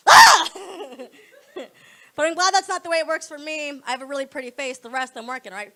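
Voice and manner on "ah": very high loud shriek; startled scream. Retold or imitated. Comic mock scream after the punchline, very high and loud. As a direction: shriek in shock.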